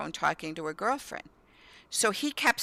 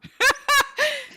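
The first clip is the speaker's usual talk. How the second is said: real shrieking laugh, much higher; high burst of laughter; 7 dB louder than usual. Real, not acted. Mom laughing about the curtains, very high laugh. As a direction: laugh hard at the memory.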